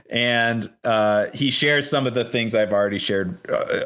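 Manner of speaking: ordinary delivery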